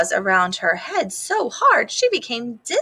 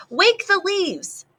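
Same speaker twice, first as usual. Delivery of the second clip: high, slow, bolder lisping call; stronger command; 10 semitones higher, slower than usual. Acted. Small rat calling bolder to his players, high and slow. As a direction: call it out with new courage. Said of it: "Wadney's voice was stronger".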